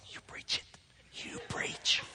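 whispered, drawn-out mock encouragement; silent-crowd whisper. Silent congregation egging the preacher on, whispered. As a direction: whisper it as if mouthing from the pews. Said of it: "people not saying anything, but looking at me".

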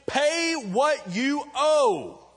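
high, loud, slow, choking demand for money; angry demand. Acted. Servant throttling his debtor, slow high angry demand. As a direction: squeeze the debt out of him, slow and high.

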